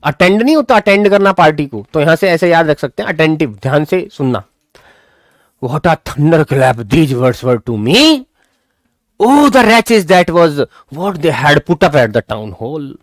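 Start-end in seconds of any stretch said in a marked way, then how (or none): 5.6-12.9 dramatic slow reading. Shown as a demonstration. Teacher reads the story's shock slowly to the class. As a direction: read it as a grim revelation.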